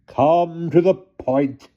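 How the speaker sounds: slow, high and curt; impatient aunt. A narrator's reading. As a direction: cut him short, impatient. Hannah demands impatiently.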